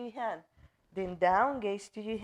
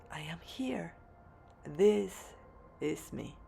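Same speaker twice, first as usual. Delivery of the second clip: guided meditation. Acted. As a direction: lead them through grounding affirmations, unhurried, leaving room to repeat. Leads a meditation.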